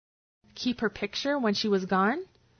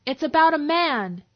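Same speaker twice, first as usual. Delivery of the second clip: raised voice to a far room; shouting; 5 semitones higher, 6 dB louder than usual. A narrator's reading. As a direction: call out loudly across the office. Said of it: "Veronica shouted toward the boss's office".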